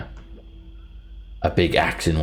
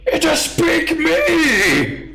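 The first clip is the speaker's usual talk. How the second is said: very high, slow, loud astonished giant cry; giant heads in unison; 5 dB louder, much slower than usual. Acted. Two heads shouting together that she speaks giant. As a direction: shout it in dumb delight. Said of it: "they both say at the same time".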